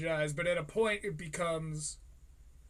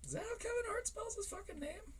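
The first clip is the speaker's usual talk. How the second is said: incredulous; 10 dB quieter than usual. Real, not acted. Mocking a misspelling.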